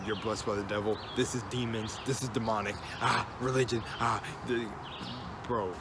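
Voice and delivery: ordinary talk, nothing marked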